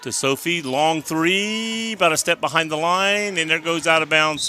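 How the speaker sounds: rising live basketball call; play-by-play. Acted. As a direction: call the shot as it flies.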